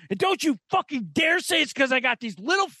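hammed-up angry dare; mock angry challenge. Retold angry challenge while pointing.

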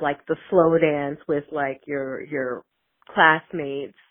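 ordinary delivery

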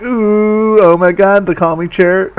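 high teasing "ooh" from a group of guys; mocking guys. The circle guys mock her over the calming chair, high and teasing. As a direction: tease her in a sing-song high chorus. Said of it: "all the other guys go like".

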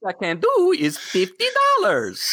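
hammed-up pawn shop haggling; Pawn Stars haggler. Co-host pitching Jar Jar's Pawn Stars, playful haggler voice. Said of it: "I just wanna watch Jar Jar's Pawn Stars".

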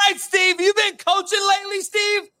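high, loud mock-coaching tease; teasing jab. Real, not acted. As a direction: needle him like a sarcastic buddy. Mocking co-host about coaching.

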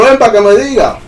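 high retold call during a chase. Dreamer chasing the flying spirit, calling out high. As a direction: call it back, pitched well up. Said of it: "empecé a perseguirlo".

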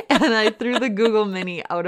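high laugh spilling into the words; words broken up by loud laughter. Real, not acted. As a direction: laugh it out while telling. Laughing through a wild story.

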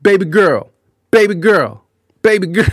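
mock come-on, each call pushed harder; pestering catcall. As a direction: imitate a persistent flirt calling out on a loop. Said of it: "he'll just stand there and just be like".

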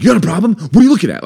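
high, loud mock confrontation; mock aggressive. Husband imitating himself glaring at strangers, mock aggressive challenge. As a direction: square up to a stranger, hammed up. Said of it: "Like, I, I lost it".

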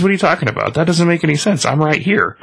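high, indignant protest; protesting guy. Retold or imitated. Dead man to the reaper, a high protesting voice.

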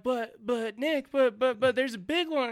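high, stammering mock protest; whiny, high-pitched imitation of himself protesting; flustered protest. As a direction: plead excitedly like an overruled kid. Retold flustered protest to the guide.

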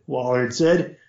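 ordinary delivery

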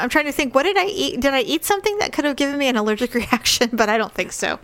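ordinary delivery

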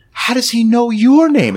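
high, loud retold outburst; mock incredulous demand. Boss catches out an informant, mock incredulous. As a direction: act out a suspicious boss's alarmed question. Retold film confrontation, sudden jump.

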